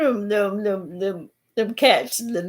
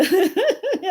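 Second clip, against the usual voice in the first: high giggle; 8 semitones higher than usual. Real, not acted. Laughing at her own joke.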